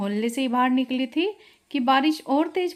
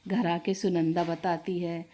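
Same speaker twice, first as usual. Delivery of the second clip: ordinary delivery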